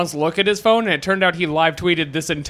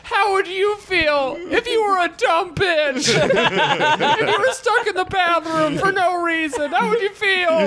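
put-on loud drunk defender; 11 semitones higher than usual. Drunk woman defending her friend's honor, mock shrieking. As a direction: wail it like an indignant drunk friend. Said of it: "defend her honor loudly".

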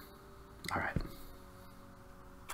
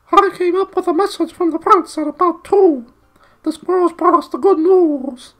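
very high, loud, squeaky owl hoot throughout; 12 dB louder than usual. A narrator's reading. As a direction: redo it as a full-on shrill owl. Owl Glenfeather speaking.

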